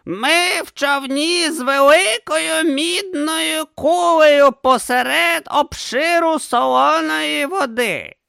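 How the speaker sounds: put-on high, slow old philosopher. A narrator's reading. Didactylos answering Urn, high and slow. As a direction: drawl it out as the old teacher. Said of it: "сказав Дидактилос".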